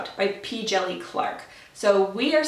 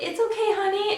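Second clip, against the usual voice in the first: much higher, lightly mocking soothing voice; mock husband comfort; 12 semitones higher than usual. Husband comforting his crying wife, mock high soothing. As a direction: imitate the husband's coddling, pitched up. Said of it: "my husband was like".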